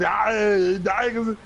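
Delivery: loud, high mock Austrian shouting; over-the-top Austrian action-star yelling; Arnold Schwarzenegger. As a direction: holler in a hammed-up Austrian accent. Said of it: "So he talks like this".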